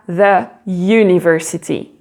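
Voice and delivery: "the" as thuh, "university" with a yoo onset; English "the university". Shown as a demonstration. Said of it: "La même chose avec university".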